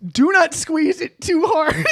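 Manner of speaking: high, drawn-out reading that cracks up; breaking into laughter. Real, not acted. Cohost reading a burger tip, losing it laughing. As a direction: read slowly while starting to lose it.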